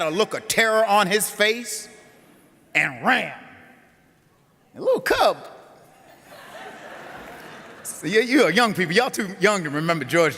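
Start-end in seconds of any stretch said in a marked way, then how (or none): 4.8-5.5 amazed. Acted. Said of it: "punchline about the cub".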